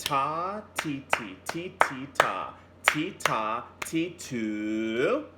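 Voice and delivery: chanted ta and ti rhythm; clapped-out ta ti rhythm chant; rhythm syllables. Shown as a demonstration. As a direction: chant "Ta, ti, ti" beat by beat. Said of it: "So it goes like this".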